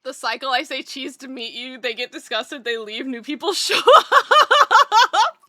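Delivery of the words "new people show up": words breaking into high, loud laughter; real laugh taking over the sentence. Real, not acted. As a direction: crack up as you finish reading. Cracks up reading a comment.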